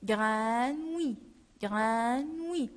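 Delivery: "grenouille" slowly, gr-a-nouille, each sound clear; slow model word. Shown as a demonstration. Teacher to pupils, modelling the word slowly.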